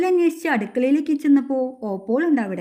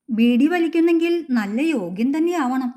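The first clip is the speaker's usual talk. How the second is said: usual speaking voice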